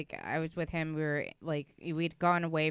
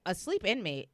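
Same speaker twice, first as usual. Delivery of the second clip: ordinary delivery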